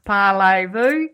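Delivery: mock Australian-accented schoolroom French, laughing. Mother mimics her daughter's French class, Australian-accented French. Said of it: "talking French with an Australian".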